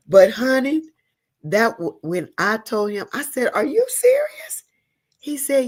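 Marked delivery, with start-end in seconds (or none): 3.5-4.6 slow, very high question; disbelieving. Retold or imitated. Woman retelling her answer to his proposal, disbelieving.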